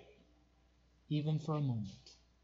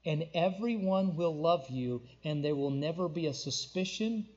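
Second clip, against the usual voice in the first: normal talking voice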